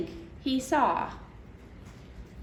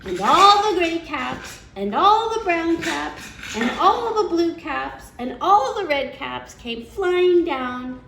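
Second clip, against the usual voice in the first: louder, higher, slow building list; slow, louder, excited storyteller build; excited narration; 6 semitones higher, 6 dB louder than usual. A narrator's reading. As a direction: read the climax with rising delight. Climax read expressively.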